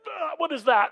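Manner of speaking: put-on a kid spitting out something gross; hammed-up disgust; disgusted surprise. Sibling tricked with food.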